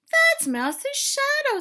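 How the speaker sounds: high, loud and slow realization; excited discovery. Acted. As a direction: shout out the discovery. Frog voiced in the story.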